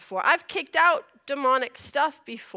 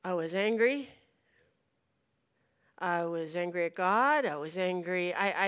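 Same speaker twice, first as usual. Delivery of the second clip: usual speaking voice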